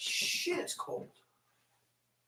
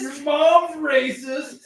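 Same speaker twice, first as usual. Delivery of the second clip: high, very drawn-out echo with laughter; laughing mock taunt; 10 semitones higher, 6 dB louder, much slower than usual. Echoing a buddy's silly insult, laughing taunt.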